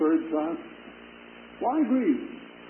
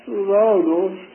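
"Thou" hit louder and drawn out, slow; emphatic thou; 3 semitones higher, 6 dB louder, slower than usual. Shown as a demonstration. Preacher stressing "Thou" in Peter's reply, slow and emphatic. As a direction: lean hard on "Thou knowest". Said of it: "the thou ... is emphatic".

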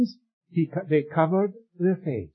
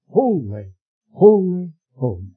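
the middle "holy" punched much louder; emphatic triple holy; 9 dB louder than usual. Acted. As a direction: hammer home "holy, holy, holy". Preacher repeats the thrice holy.

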